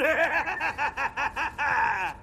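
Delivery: gloating evil laugh; evil villain laugh. Acted. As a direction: cackle over your trap. Villain springs his trap.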